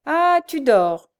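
loud, high, drawn-out exclamation; crying out. A narrator's reading. Frochard discovers the blind girl asleep, crying out. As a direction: call out slowly and menacingly loud. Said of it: "s'écria la Frochard".

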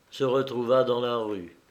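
ordinary delivery

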